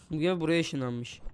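normal talking voice

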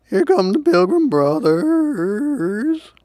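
high, slow panicked wail; turkey's dismayed cry. A narrator's reading. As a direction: stretch it out as a wailing turkey. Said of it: "cried the turkey".

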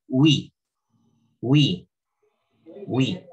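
"we" with stress on the ee; accented word. Shown as a demonstration. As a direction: repeat "we" firmly, separated by pauses. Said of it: "le colocamos el acento".

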